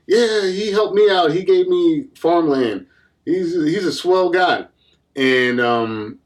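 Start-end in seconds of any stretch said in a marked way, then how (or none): 0.1-4.6 slow, high-pitched mock testimonial; courtroom supporters. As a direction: stretch it out like an admiring townsperson. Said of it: "People in the courtroom are like".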